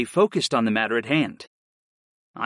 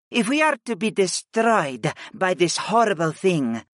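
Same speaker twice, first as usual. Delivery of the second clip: higher, measured, yearning character voice; pleading goddess; 6 semitones higher than usual. Acted. Goddess begs master before possible doom, pleading. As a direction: plead with the master, slowing at the end.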